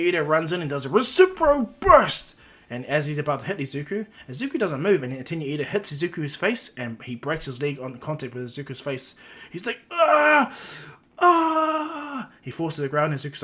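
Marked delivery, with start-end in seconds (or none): 0.9-2.3 high, loud anime battle cry; hammed-up attack yell; attack call. Character calls out special move. 9.9-12.3 high, drawn-out mock scream; hammed-up cry of agony; loud acted wail of pain. Character breaks leg, cries out.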